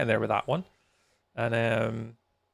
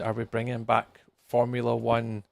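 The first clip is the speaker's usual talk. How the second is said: usual speaking voice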